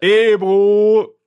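loud, high announcer call; hype intro. Acted. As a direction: announce the name like a show host. Introducing co-host with fanfare.

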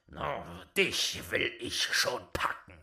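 loom over the little wretch; menacing old giant. Acted.

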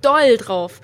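slow, loud, gushing excitement. Real, not acted. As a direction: stretch it out with delight. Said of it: "ich freu mich einfach wirklich". (überdreht vor Vorfreude)

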